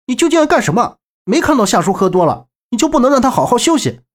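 high, sharp angry protest; fierce scolding. A narrator's reading. Young man shielding drunk uncle from visitor, high and fierce. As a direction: block him with a heated telling-off. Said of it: "周震语气凶着喝道".

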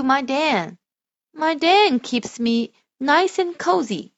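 loud, slow storybook voice; reading to children. Shown as a demonstration. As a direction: read it slowly and grandly for a child. Parent models expressive reading.

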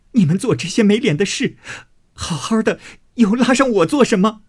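high, wailing protest of the wronged; tearful angry scolding. Acted. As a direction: cry out in injured anger. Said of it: "只气得干哭， 骂道". (气得干哭着骂)

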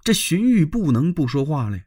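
usual speaking voice

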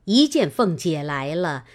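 usual speaking voice

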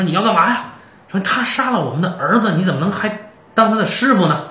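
high, loud retold outburst; a hammed-up burst of alarm and anger; upset ex-wife. Ex-wife confronts him about their son's killer, high loud anger. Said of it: "这女的当时就急了".

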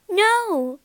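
high, loud cry of horror; horrified cry. A narrator's reading. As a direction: cry out in shock. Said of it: "cried Beauty, horrified".

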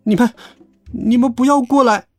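high, halting plea; frightened stammer. A narrator's reading. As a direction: stammer and back away in fear. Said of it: "结结巴巴地说".

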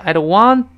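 want punched, the rest light; stress on want. Shown as a demonstration. Teacher showing students where the stress falls. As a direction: hit the word want. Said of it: "want在这重读一下".

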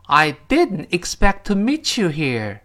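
slow, clear repeat; slow model reading. Shown as a demonstration. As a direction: say every word clearly, slowly. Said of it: "争取把每个字读清啊". (慢速清晰再读一遍)

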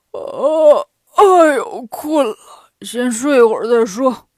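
loud groaning pig character giving in to sleep; sleepy Pigsie. A narrator's reading. Pigsie skipping patrol for a nap, sleepy. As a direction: groan wearily as the lazy pig.

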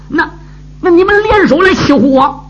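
high, indignant outcry; angry cry. A narrator's reading. Young woman turns on the two who teased her, angry cry. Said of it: "翻脸一喊喝道".

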